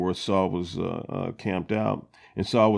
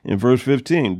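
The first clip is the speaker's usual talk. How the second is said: ordinary talk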